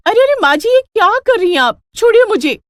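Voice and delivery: high, loud frightened pleading; panicked pleading. Acted. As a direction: beg her to stop, terrified. Fleeing mother-in-law with scissors. (घबराकर ऊँची आवाज़ में गिड़गिड़ाना)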